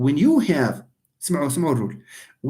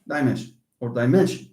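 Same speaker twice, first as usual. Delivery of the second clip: "dimension" with the weight on MEN; stress on penultimate; 5 semitones lower, faster than usual. Shown as a demonstration. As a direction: stress the middle syllable of "dimension". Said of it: "See, stress occurs, falls on".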